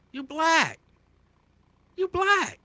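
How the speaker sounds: high, rising insistence; emphatic high insist. Acted. Insisting at celebrities.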